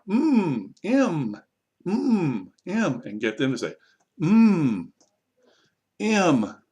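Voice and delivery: long, high, hummed "Mm" before a clear "M"; drilling the letter sound. Shown as a demonstration. Teacher drilling a letter for repetition, humming "Mm" long. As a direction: stretch "Mm" loudly, falling, then name "M". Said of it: "I actually start with a card".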